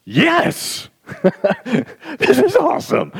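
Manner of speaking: high, loud whoop with a laugh; excited cheer. Retold or imitated. Someone fresh to grace cheering about it. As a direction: burst out with joy. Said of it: "and you can say".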